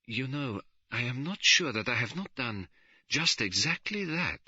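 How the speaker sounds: slow. A narrator's reading. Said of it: "Poirot said slowly".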